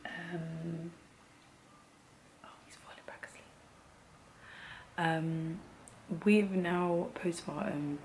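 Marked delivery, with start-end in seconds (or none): 2.4-3.4 very soft, quick whisper; barely audible, under the breath; hushed aside. Real, not acted. Mum noticing her baby falling back asleep, hushed aside.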